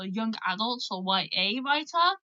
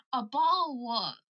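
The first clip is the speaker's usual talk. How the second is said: "a bottle of water" in British English, with a glottal stop for the t in "bottle"; British pronunciation. Shown as a demonstration. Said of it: "为了你们啊，一二三啊".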